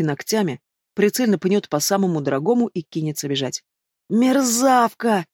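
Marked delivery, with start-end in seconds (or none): 4.1-5.3 high, drawn-out howl of pain; howling wail. A narrator's reading. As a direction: wail it out, slow and strained. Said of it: "взвыл белуга". (протяжно взвыть от боли)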